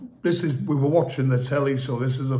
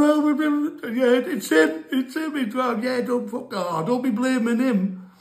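put-on crying kid; 11 semitones higher, slower than usual. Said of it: "I'm crying my eyes".

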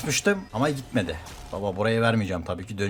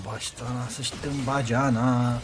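slow drawn catchphrase; much slower than usual. Acted.

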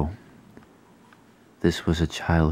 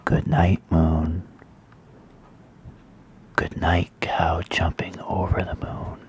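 bedtime story reading; 5 dB quieter than usual. Acted. Reads bedtime story to viewers.

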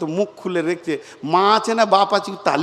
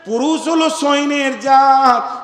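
high preaching cry; 10 semitones higher, 5 dB louder, slower than usual. Acted.

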